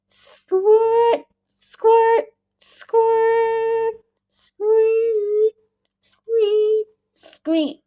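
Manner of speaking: high squeaky squirting noises; storyteller making the squirt of milking; milk squirt sounds. A narrator's reading. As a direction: imitate milk squirting into a pail. Milk spraying into the bucket.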